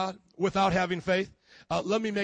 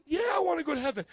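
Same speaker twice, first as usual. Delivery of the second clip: eager volunteer; 8 semitones higher, faster than usual. Acted.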